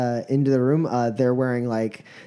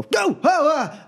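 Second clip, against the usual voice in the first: high startled cry of surprise; sudden high yelp, caught off guard; 13 semitones higher, slower than usual. Acted. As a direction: jump at the sight of strangers. Startled laborers round corner.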